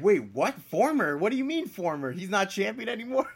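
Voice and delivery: high, baffled double take; rising shock at the news; confused disbelief. Retold or imitated. Retelling own confused reaction.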